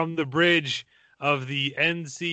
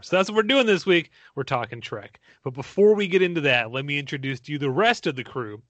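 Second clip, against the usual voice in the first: usual speaking voice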